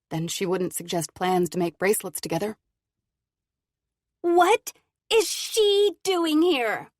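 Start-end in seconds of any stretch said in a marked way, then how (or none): 4.2-6.9 high, loud, slow shrill demand; shrill angry. Acted. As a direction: shriek it from the doorway. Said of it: "A shrill voice comes from the doorway".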